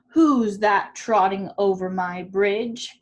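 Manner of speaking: troll growl. A narrator's reading. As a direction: drag it out like a grumpy troll. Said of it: "growled the troll".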